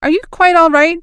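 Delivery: high, loud worried blurt; alarmed question. Acted. Young officer startled by the laughing officers, alarmed. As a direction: ask in sudden alarm. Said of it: "blurted Partridge".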